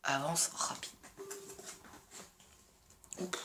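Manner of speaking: whispered aside. Real, not acted. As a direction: breathe it as a whisper.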